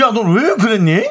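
gruff Gangwon dialect question; Gangwon accent. Shown as a demonstration. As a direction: ask kindly but sound angry in Gangwon dialect. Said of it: "다정하게 얘기해도 화내는 것처럼 들립니다".

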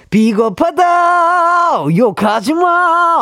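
high, slow comic shouting; mock shout. Host hams up a famous movie line with a shout. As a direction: yell it like a melodramatic actor.

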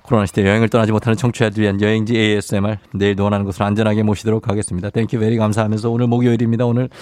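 low, hushed ASMR narration. Acted. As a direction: speak low and close like ASMR. Low ASMR travel segment.

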